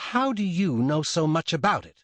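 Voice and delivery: demanding. Acted. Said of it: "Susanna demanded".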